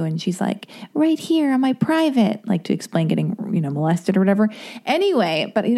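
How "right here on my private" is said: put-on raised, small child voice; child guest star. Child witness answering the detective, lightly childlike. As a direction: play an eight-year-old witness, lightly. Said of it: "an eight-year-old guest star".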